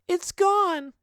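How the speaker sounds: put-on very high child's voice, called out; little girl yelling back. A little girl answering Daphne from afar, high child's call. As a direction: pitch it up like a small girl shouting back. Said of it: "a little girl yells back".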